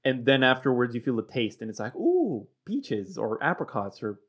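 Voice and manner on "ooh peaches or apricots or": mock discovery of a fruity aftertaste; light swoop of delight; pleasant surprise.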